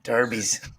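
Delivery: Derbies mimicked low in the odd accent; Derbies, a mock echo of the friend's accent; mocking his accent. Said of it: "I don't know where that accent comes from".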